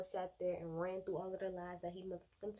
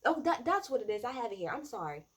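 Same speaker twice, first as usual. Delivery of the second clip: normal talking voice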